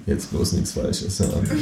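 whispered worried thought; nervous inner whisper. Acted. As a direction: tell yourself under your breath.

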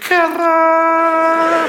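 loud high call across the room; called out name. Acted. Comedian calls out to Kurti, loud and high.

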